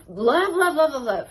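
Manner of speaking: gushing. Real, not acted. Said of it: "You know what I love?".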